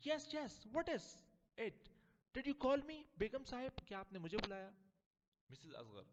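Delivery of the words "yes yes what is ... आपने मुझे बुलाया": high-voiced, eager servant; a lightly voiced, overeager servant. Servant eagerly answering the mistress's call.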